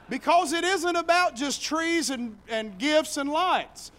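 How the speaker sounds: loud, high, fervent preaching; full-force preaching. Acted. Preacher building to the Christmas climax, full force. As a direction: preach it to the rafters.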